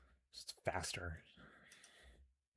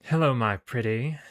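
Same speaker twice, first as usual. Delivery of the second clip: louder, higher British villainess; British accent; 4 semitones higher, 11 dB louder, slower than usual. Acted. Commander greets her enslaved agent, British accent. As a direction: purr it grandly in a British accent. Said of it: "I gave her a British accent".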